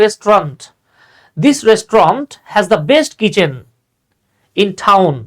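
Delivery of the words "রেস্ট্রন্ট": "রেস্টুরেন্ট" as res-tront; English-style "রেস্টুরেন্ট", middle vowel dropped; English pronunciation. Shown as a demonstration. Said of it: "ইংরেজিতে বলতে হয় এটাকে".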